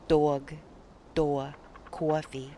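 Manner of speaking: slow New York aw in dog, door, coffee; New York vowel demo; New York or sounds. Shown as a demonstration. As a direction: stretch the aw like a New Yorker. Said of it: "New Yorkers talk with a lot of or sounds".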